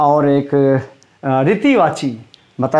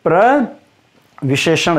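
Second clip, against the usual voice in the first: slow, drawn-out term; much slower than usual. Shown as a demonstration. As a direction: stretch प्रविशेषण slowly, syllable by syllable. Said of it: "क्या बोला जाता है जी?". (धीरे-धीरे खींचकर प्रविशेषण)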